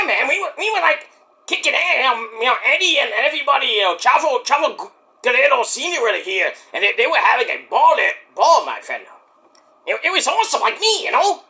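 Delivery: excited, high mock Mexican accent; boastful character in a put-on Mexican accent; Mike. Mike bragging about the wrestlers, high mock Mexican accent. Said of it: "why did I give you a Mexican accent, Mike".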